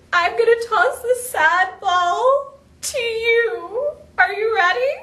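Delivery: whiny, drawn-out fake sobbing, pitched high; mock crying. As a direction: wail slowly as if bawling over it. Catches sad ball, pretends crying.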